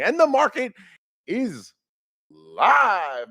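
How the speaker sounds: loud, high announcer hype; radio host intro. Acted. Host welcomes viewers back, loud announcer hype. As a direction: announce the show like morning radio.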